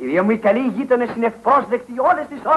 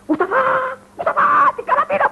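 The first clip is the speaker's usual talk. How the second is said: retold shout. Retelling the lion story, shouting for his helper. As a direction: yell for Moustafa in a panic. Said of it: "φωνάζω".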